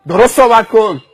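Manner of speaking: loud, commanding reprimand; sharp rebuke. Acted. Roadside man to a cheeky driver, sharp rebuke. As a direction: put the driver in his place.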